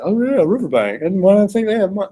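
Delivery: mock-dim goofball catching on. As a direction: play up a naive goofball a little. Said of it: "you have to be a goofball and say".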